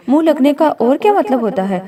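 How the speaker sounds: ordinary delivery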